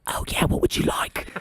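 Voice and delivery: hushed whisper back, as if keeping secrets; mock secretive whispering; conspiratorial whisper. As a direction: imitate staff whispering along. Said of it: "we're keeping secrets now".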